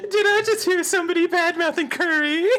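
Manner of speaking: put-on high girlish accusing line; hammed-up high anime girl; bad Chie voice. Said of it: "I'll just do horrible".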